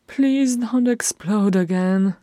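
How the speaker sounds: high, drawn-out complaint; moaned plea. A narrator's reading. Freyrin begging Thorin to keep calm, high slow moan. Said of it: "Freyrin moaned".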